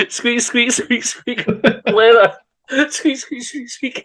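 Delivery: high squeaky mimicry of leather; high mock leather squeak; squeaky leather noise.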